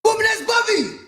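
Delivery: acted high-pitched shriek of shock; shocked shout. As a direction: yell it in hammed-up disbelief. Retelling shocked discovery in the video.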